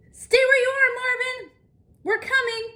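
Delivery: high, loud call across a distance; urgent high calling out. Acted. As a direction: shout it so Marvin hears far off. Calling out to lost moose.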